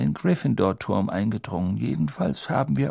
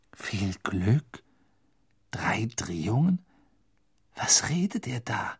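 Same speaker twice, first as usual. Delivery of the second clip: bewildered Harry; 3 dB quieter than usual. A narrator's reading. Harry confused, quietly questioning Dumbledore's words. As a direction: mutter in confusion.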